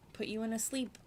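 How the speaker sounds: ordinary talk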